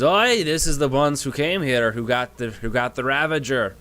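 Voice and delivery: high, loud dwarf voice; a brash little dwarf barking at the party; gruff dwarf. Acted. Dwarf on the giant's shoulder accusing the party, brash. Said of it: "And the dwarf goes".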